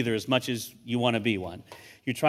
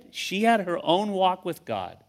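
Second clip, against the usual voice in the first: usual speaking voice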